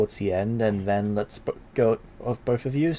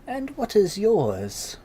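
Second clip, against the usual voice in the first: slow higher crone voice; hag voice; 11 semitones higher, slower than usual. Acted. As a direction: ask it slowly like a crone.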